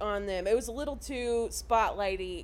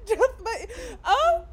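put-on high, laughing old-English flutter; flustered Winifred; 8 semitones higher, 4 dB louder, slower than usual. Host imitating Winifred flattered by fans, high and laughing. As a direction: swoon through the archaic words, giggling. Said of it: "Winnie's like".

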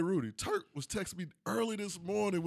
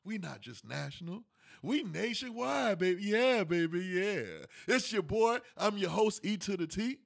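big hype host intro, raised; grand entrance announcement; 5 semitones higher than usual. Acted. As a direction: announce the show like a ring MC. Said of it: "host's grand opening announcement".

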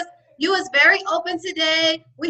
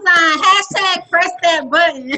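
hype call; 3 semitones higher, 7 dB louder than usual. Acted.

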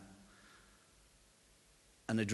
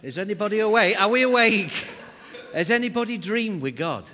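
loud, high call to wake them; rousing call; 9 semitones higher, 7 dB louder than usual. Real, not acted. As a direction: jolt the room awake. Preacher rousing the congregation.

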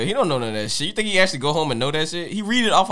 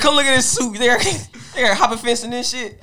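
higher excited call; 8 semitones higher, 3 dB louder than usual. Retold or imitated. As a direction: call a friend over eagerly. Calling a friend over.

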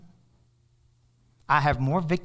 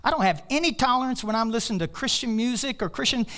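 ordinary talk

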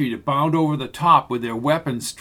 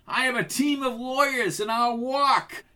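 higher defiant shout; 9 semitones higher than usual. A narrator's reading. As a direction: throw defiance back at the cop. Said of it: "shouted at Jansen".

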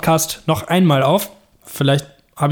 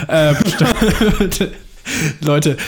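lachend; much slower than usual. Real, not acted. Lacht über fehlende Facebook-Seite.

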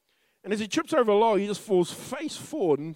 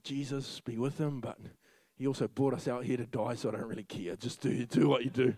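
muttered mock prayer; 9 semitones lower, 8 dB quieter than usual. Mock prayer lying in bush.

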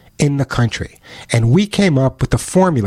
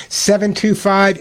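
usual speaking voice

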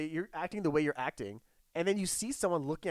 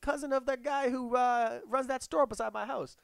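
mock local; 10 semitones higher than usual. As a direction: tease them with fake friendliness. Voicing a local to the guys.

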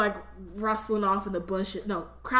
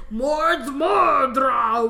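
long, drawn-out hammy spell cry; shouted dark incantation; 3 semitones higher, 7 dB louder, much slower than usual. Acted. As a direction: bellow it slowly like a hammed-up evil spell. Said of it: "then they hear the words".

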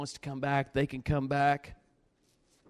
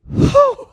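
loud, piercing "whew" of shock; high stung whoop; 12 dB louder than usual. Retold or imitated. Head rocked by the slap, high reeling whoop. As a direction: let out a high whoop after a blow.

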